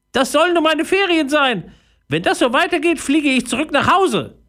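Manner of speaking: tiny high voice, grumbling; a little fairy sulking about her ruined trip; sulky fairy. A narrator's reading. Fairy in her tent complains, sulky high voice.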